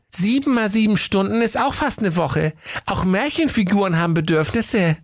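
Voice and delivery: high-pitched princess voice. Acted. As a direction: argue back in a high, pert voice.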